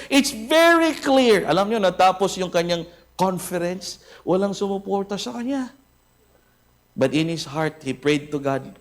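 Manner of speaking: usual speaking voice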